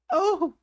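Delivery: high, loud frightened gasp-cry; a shocked yelp of terror; near scream. A narrator's reading. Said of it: "sigh that was almost a scream".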